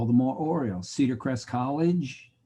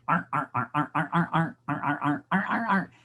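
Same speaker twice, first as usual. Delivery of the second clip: mock seal barks, a bit higher, in rhythm; seal barking a tune; 5 semitones higher than usual. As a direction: bark like a seal honking a song. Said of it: "It's going".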